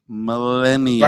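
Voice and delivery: Millennial stretched out slowly; slow careful try. Real, not acted. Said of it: "say it one more time".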